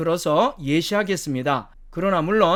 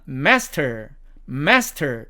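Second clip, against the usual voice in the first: American "master", front a and sounded r; American demo of "master", r kept; American accent; 4 semitones lower, much slower than usual. Shown as a demonstration. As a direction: say "master" as American "mes-ter". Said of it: "미국식 발음으로 읽으면".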